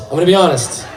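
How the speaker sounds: ordinary delivery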